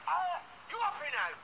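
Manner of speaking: loud, high cry of grievance; outraged protest. Acted. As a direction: protest the blow at full voice. Protesting after being hit.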